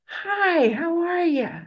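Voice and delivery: high-pitched, drawn-out friendly holler; loud, very friendly. Retold or imitated. Old man greeting an angry drunk, high, very friendly call. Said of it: "ear-splittingly loud, very friendly".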